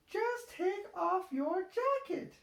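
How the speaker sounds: high little-pig voice, cheerful; smiling Peppa. A narrator's reading. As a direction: smile as Peppa helps Daddy. Peppa voice held.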